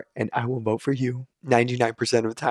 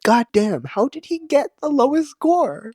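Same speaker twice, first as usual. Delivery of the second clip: disbelief. Real, not acted. Outraged at the vote result.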